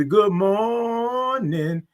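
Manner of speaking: "morning" held very long, high; drawn-out good morning. Real, not acted. Host greeting all the viewers, "morning" drawn way out. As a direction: stretch "mor" into a long high note, then land "ning". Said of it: "all those O's and R's and I N G's".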